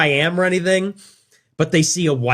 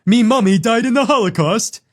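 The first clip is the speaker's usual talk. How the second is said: higher, louder mock British accent; put-on British accent; 6 semitones higher, 3 dB louder than usual. Joking in the clip with a put-on British accent. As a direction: chirp it like a jokey British bloke. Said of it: "a British person".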